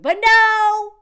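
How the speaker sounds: loud, high exclamation; exasperated shout; indignant outburst. Real, not acted. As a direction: belt it out in exasperation. Indignant at man's public proposal.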